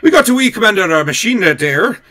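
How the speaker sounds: loud, high hammed-up Irish voice; Irish accent. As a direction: lay on a shaky-at-best stage Irish. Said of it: "will read the top ten in an Irish voice".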